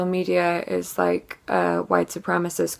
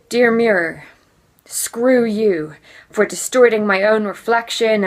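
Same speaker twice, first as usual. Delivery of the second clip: loud, raised, declamatory slam poet delivery; angry slam poetry; 3 semitones higher, 7 dB louder than usual. Acted. Feminist slam poet addresses her mirror, angry slam recital. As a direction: hurl each phrase at the mirror, falling hard at the ends.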